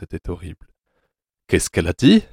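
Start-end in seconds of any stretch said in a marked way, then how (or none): 1.5-2.2 lightly voiced gruff man, rising on the question. A narrator's reading. Anatole questions his accomplice about the doctor, lightly gruff. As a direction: ask it with a touch of gruffness. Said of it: "de sa voix bourrue".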